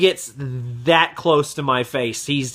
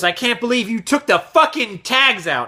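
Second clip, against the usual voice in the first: higher-pitched mock angry customer; louder retold anger; 7 semitones higher than usual. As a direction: imitate an irate customer escalating. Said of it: "And he gets even louder".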